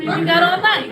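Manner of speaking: seductive coaxing; sultry teasing. Acted. Acting out sex toy talk.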